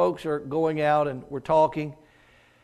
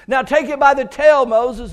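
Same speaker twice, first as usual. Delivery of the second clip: louder, higher divine command; commanding God; 7 semitones higher, 7 dB louder than usual. Acted. God ordering Moses to grab the serpent, commanding.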